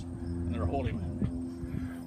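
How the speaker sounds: slow, low, solemn intoning; deep and slow for the punchline; solemn holy man. Acted. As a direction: draw it out like a reverent chant. Punchline of lodge joke.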